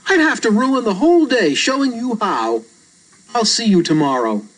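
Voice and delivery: put-on a matter-of-fact young boy; a hint of a casual boy explaining; boy character voice. Reader voices Albert.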